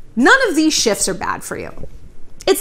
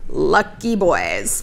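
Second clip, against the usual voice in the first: lower, very slow dry repeat; low deadpan; 7 semitones lower, much slower than usual. Real, not acted. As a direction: drop it flat and slow.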